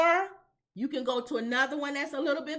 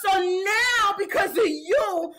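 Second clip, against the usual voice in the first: put-on higher, louder laughing Chicano accent; Chicano gangster accent; 5 semitones higher, 4 dB louder, slower than usual. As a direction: threaten the snitch in a put-on Chicano voice. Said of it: "why you gotta be".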